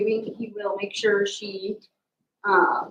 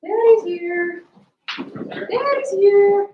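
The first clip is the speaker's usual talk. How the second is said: excited coo; 12 semitones higher, 7 dB louder, much slower than usual. Real, not acted. Greeting dogs, cooing.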